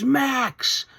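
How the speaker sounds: high-pitched imitation of a scream; retold scream. Renee trying to get Max to listen, retold scream. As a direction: call the name out high, mimicking a scream. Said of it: "Renee just screams".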